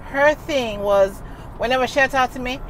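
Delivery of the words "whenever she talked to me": ordinary talk, nothing marked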